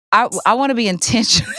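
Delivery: laughing. Real, not acted. Laughing burst mid-sentence.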